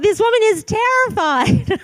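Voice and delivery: high, loud, laughing through the minister's imagined thought; laughing mock thought. Minister sizing up the nervous woman, laughing. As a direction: play his guess at her fear, cracking up. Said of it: "he probably thought".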